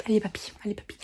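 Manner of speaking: whispering allez papy teasingly; whispered mock. Real, not acted. Teasing the old man in a whisper to viewers. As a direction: tease under your breath.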